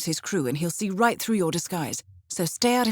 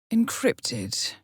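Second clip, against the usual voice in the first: whisper. Acted. Agent examines encrypted item.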